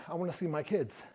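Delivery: soft plea. Retold or imitated. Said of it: "the other half was like".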